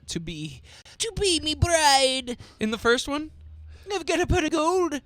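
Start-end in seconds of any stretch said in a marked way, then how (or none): 1.0-3.3 leprechaun voice. Retold or imitated. Mock leprechaun bride question. 3.9-5.0 high Irish leprechaun voice; squeaky mock leprechaun.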